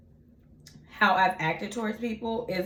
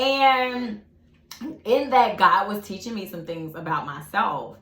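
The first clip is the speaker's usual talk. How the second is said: ordinary delivery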